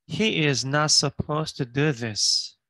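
very slow, every word clear; slow model reading. Shown as a demonstration. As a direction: read it word by word for learners. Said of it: "teacher repeating slowly".

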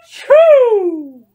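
very loud, long-drawn sneeze; explosive storybook achoo, high then falling; huge mock sneeze. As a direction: let a giant sneeze rip. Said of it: "I sneezed a great sneeze".

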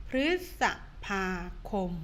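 พฤษ ภา คม with a pause between each syllable. Shown as a demonstration. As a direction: break พฤษภาคม into its beats. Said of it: "อ่านว่า". (แยกพยางค์ พฤษ ภา คม)

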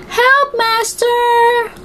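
very high, slow plea; a frightened magic harp calls out to its master; the stolen harp calls to its master for help; harp crying for help. A narrator's reading. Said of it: "the harp cried".

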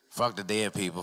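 normal talking voice

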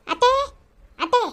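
high, rising calls; high rising calls of "ಅತ್ತೆ"; calling out. Acted. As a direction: call the mother-in-law out of the house. Daughter-in-law calling again.

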